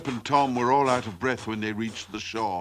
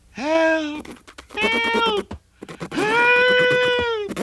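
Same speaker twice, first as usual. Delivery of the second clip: extremely high, drawn-out squeals; panicked screams for help; much slower than usual. A narrator's reading. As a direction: shriek like a terrified chick. Chick panics again.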